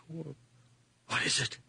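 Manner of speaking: slow, soft, trembling question; fearful hush. Acted. Old man in the dark asking what is there, slow and afraid. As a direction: ask it slowly, afraid of the answer.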